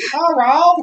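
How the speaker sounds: high, loud mock Chinese accent. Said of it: "This is my Chinese accent".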